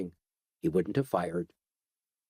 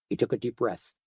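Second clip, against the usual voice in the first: plain reading voice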